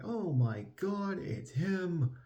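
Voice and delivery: high, slow stunned gasp; shocked crowd gasp. Retold or imitated. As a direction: squeal it out in shock. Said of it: "everyone's just like".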